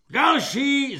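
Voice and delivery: high, loud bellowed call; holler. A narrator's reading. Corporal calling the next recruit, hollering. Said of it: "zahulákal svobodník".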